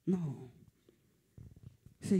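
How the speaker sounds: ordinary delivery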